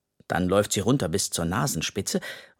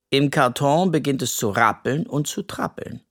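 usual speaking voice

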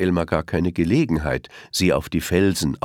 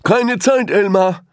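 much higher, louder rushed brush-off; hurried elephant; 8 dB louder, slower than usual. A narrator's reading. Elephant rushes past Elmar, high hurried brush-off. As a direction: wave him off in a hurry. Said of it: "sagte einer der Elefanten".

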